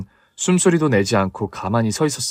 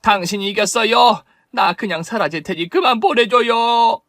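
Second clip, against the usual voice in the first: pleading woman; 6 semitones higher than usual. Acted. Woman begging mother to stop.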